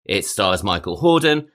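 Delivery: usual speaking voice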